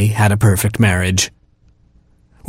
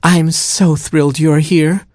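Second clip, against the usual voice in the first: put-on higher, tender murmur to the baby; murmured tenderly; 7 semitones higher, slower than usual. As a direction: breathe it lovingly to the newborn. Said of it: "she murmured to her baby".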